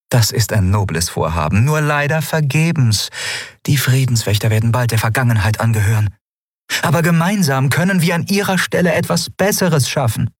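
calm, polite ruler holding back a secret; lightly voiced empress. A narrator's reading.

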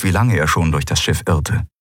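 plain reading voice

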